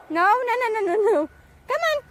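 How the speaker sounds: high, loud rapid nos; urgent call-off. Real, not acted. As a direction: pull the dog back fast. Dog heading into pond.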